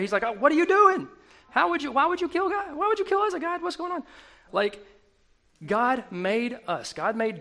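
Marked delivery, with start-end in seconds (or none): 0.2-4.0 loud, high-pitched, indignant protest at God; hammed-up outraged yelling, pitch way up; angry outburst. Preacher acting out David confronting God over Uzzah, angry. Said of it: "He was legitimately angry".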